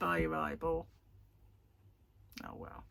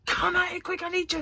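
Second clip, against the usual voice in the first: urgent summons; 11 semitones higher than usual. Retold or imitated. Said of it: "it sounded like".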